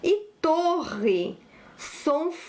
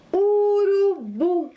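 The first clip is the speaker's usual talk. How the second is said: "Urubu" stretched long on a raised pitch; slow dictation; 6 semitones higher, 4 dB louder, slower than usual. Shown as a demonstration. Teacher presents a new word to pupils, slow dictation. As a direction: draw out each vowel of "Urubu", high.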